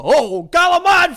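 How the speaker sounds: high, loud, slow hammed-up calamari; mock Italian accent. Imitating dad ordering calamari, hammed-up Italian accent. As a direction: drawl it out like an old Italian dad. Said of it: "My dad said all the".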